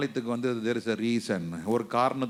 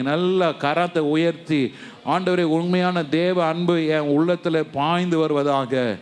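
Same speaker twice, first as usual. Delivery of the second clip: high, loud urgent exhortation; full-force preaching; 4 semitones higher, 5 dB louder than usual. Acted. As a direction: drive the crowd to pray.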